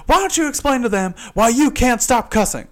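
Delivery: high, loud demand; accusing coworker. Retold or imitated. Coworker confronting him before managers.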